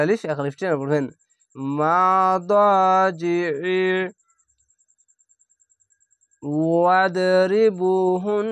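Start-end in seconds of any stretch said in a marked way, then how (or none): 1.8-8.5 slow, drawn-out chanted recitation; Quranic recitation. Shown as a demonstration. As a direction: recite each word slowly with tajweed. Recited demo.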